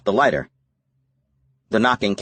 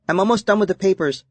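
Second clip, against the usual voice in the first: put-on higher girlish voice, matter-of-fact; a kid reporting in, higher; young girl voice; 11 semitones higher than usual. A narrator's reading. Sydney talking to Mitch.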